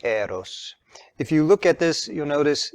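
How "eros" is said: Eros whispered, EH-ros with a short open e; whispered Greek word. Shown as a demonstration. Teacher whispers Eros, EH-ros, while writing it on the board. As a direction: breathe Eros out softly, EH-ros. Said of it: "it's written like this".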